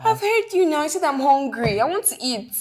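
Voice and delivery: loud, high, exasperated insistence; impatient outburst. Acted. Hungry friend cutting off an apology, loud and impatient. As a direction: burst out loudly to cut off the apology.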